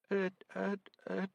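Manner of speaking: high, stuck nervous stutter. A narrator's reading. Terrified recruit stuck mid-answer, stuttering high. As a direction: freeze up on the sound. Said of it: "Nourishing stuttered".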